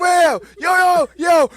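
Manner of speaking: loud high shout after someone. Retold or imitated. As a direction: yell to get her attention. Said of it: "And we're like".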